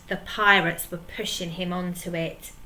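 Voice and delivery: ordinary delivery, nothing marked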